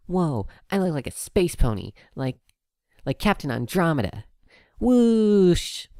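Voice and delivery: high, loud delighted filly building to a whoosh; filly pretending to be a space pony; excited filly. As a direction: zoom off like a space hero.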